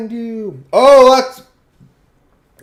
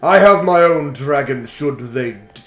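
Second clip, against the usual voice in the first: lower, slow, grand trainer voice; boastful dragon master; 4 semitones lower, slower than usual. Acted. Reading Cole's dialogue box, a slow lower boastful dragon master. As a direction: puff up as a self-important dragon master.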